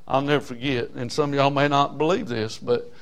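ordinary talk

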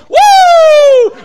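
high, slow, drawn-out whoop; joyful church holler. Retold or imitated. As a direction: let the woo soar way up. Man running bases in church.

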